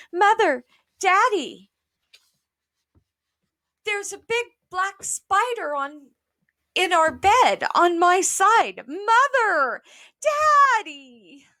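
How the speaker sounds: put-on a child running in shrieking for mother; screaming for parents. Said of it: "went screaming into her mother".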